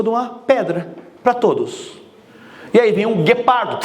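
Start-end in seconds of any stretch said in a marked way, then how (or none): none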